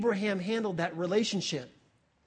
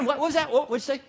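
eager anticipation; 3 semitones higher, faster than usual. Acted. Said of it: "he's waiting with anticipation".

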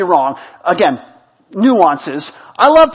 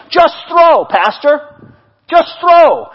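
raised, loud retold exhortation; emphatic urging; 6 semitones higher, 4 dB louder, slower than usual. Friend urging his pastor to take the risk, loud and emphatic. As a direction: punch it out high and loud.

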